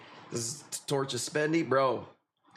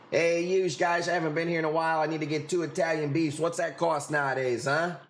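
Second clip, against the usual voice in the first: put-on Chicago accent; 3 semitones higher than usual. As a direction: play a pushy Chicago customer with attitude. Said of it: "start off like a true Chicagoan".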